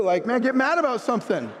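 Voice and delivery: high-pitched mild retold irritation; mock exasperation. Coach goading his too-calm colleague, mock exasperated. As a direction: goad him in mock annoyance. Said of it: "I wanna get mad at him too".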